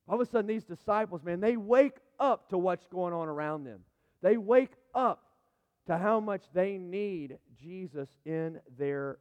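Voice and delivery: preacher swelling with excitement; rising excitement. Real, not acted. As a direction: build the fervour to a peak. Preacher building to a climax.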